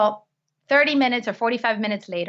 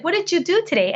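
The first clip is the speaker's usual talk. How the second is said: high-pitched dad voice; 9 semitones higher than usual. Retold or imitated.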